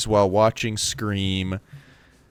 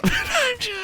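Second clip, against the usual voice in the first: high shrieking laugh. Real, not acted. Big laugh burst.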